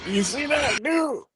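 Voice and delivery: high squeaky disbelief; can't believe the clip, squealing. Real, not acted. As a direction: shriek it way up in pitch.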